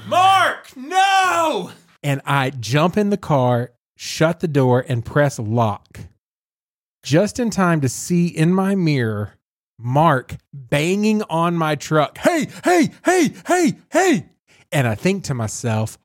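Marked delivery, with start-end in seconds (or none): 0.1-1.7 retold scream. Nurse screaming at the patient who runs off, panicked shout. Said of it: "I hear the nurse scream behind me". 12.2-14.3 high, insistent shouts of hey; mock angry yelling. Patient banging on the truck calling out, high insistent yells.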